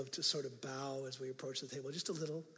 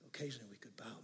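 whisper; 7 semitones lower, 14 dB quieter, faster than usual. Real, not acted. Confiding suggestion to congregation.

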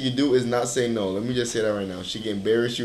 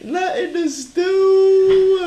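high, slow, laugh-squeezed tease; cracking up while teasing; words drawn out through a laugh; 6 dB louder, much slower than usual. Real, not acted.

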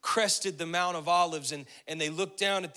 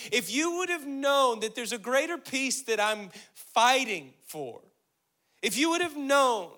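higher, louder pleading sermon voice; impassioned preaching; 6 semitones higher, 5 dB louder than usual. Acted. Preacher voicing Jesus' lament over Jerusalem, impassioned.

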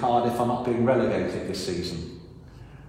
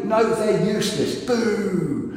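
jeering crowd, higher; mock booing; 6 semitones higher, slower than usual. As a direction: boo them like a fickle crowd.

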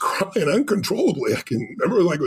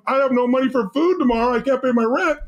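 mock panic, higher voice; a touch of hammed-up money worry; imagined freak-out; 6 semitones higher than usual. Man voicing the panic he never felt, mock freak-out. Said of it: "I should have been freaking out going".

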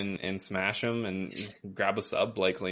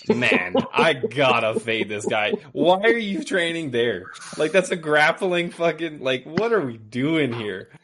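exasperated; 7 semitones higher, 6 dB louder than usual. Real, not acted. Baffled by fighter's gym choice.

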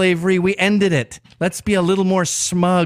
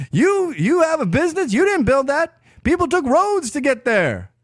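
higher-pitched mock Obama in a Southern accent; 9 semitones higher than usual. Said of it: "Why does he talk Southern".

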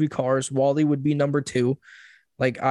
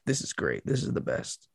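quiet reverent whisper, fading out; mock-reverent, softer and softer; hushed awe; 2 semitones lower, 9 dB quieter than usual. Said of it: "People would just be like".

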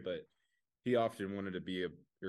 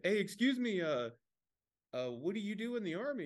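higher, mock-polite challenge; a man confronting a fake veteran outside, pitched-up mock voice; confronting questioner; 10 semitones higher than usual. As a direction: play the guy calling out a fake soldier, pitched up. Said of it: "and he's like".

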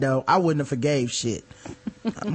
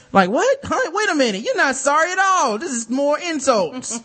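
indignant disbelief; 9 semitones higher than usual. Acted. Host answering a condescending apology, indignant disbelief. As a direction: protest the backhanded apology in high-pitched disbelief.

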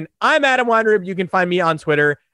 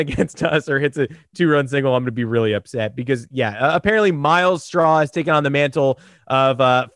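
normal talking voice

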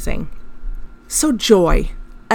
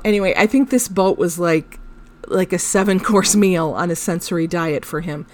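ordinary talk

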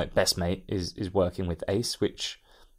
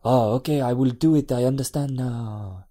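put-on French accent, slow at the end; fake French accent; 3 semitones higher, 4 dB louder than usual. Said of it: "You're very good at this French stuff".

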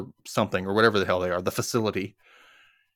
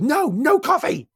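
high, drawn-out mock outburst; yelling; 3 dB louder, slower than usual. Katemski refuses Patty's offer of coffee, high mock yelling. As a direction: act out an overwrought refusal, pitched way up. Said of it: "this guy yelling at my wife".

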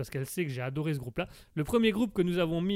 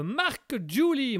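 slow, loud name said with a hammed-up English accent; over-the-top English accent; exaggerated English accent; 8 semitones higher, 5 dB louder, much slower than usual. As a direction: draw out the name like a mock American host. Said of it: "vous aurez compris aussi que mon accent anglais".

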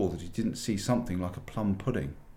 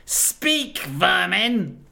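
much higher, louder contemptuous command; furious witch; 11 semitones higher, 9 dB louder, much slower than usual. A narrator's reading. Witch queen berates the silent animals, high and furious. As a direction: spit the words at them. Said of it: "she said again".

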